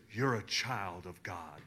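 low, soft and slow; calm pronouncement. Retold or imitated. Masseur stops and tells the player, low slow hush. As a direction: say it quietly, like a sudden revelation.

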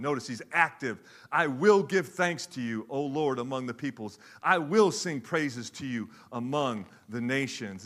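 psalm read with full voice; scripture reading. Acted. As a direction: proclaim it like a psalm.

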